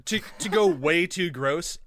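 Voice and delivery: ordinary talk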